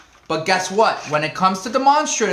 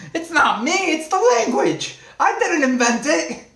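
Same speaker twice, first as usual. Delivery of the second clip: laughing protest; 8 semitones higher than usual. Real, not acted.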